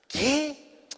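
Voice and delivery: high-pitched questioning yelp of disbelief; imitated startled mother. Mother asked to lend pesos, mock surprise. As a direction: react like a mother taken aback. Mamá reacciona al pedirle dinero.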